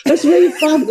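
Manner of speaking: very high-pitched mock-female delight; delighted women. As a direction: squeal it like a charmed fan. Mimics women enjoying his accent.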